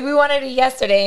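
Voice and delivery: loud, high mock insistence; impatient demand. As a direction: insist on it, playfully exasperated. Repeating the impatient demand, amused.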